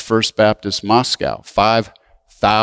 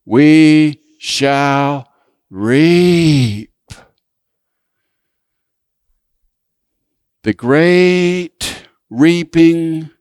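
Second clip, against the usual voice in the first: very slow, drawn-out call to repeat; leading congregational recitation; 3 semitones higher, much slower than usual. Real, not acted. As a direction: stretch each word for the congregation to join. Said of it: "say it with me".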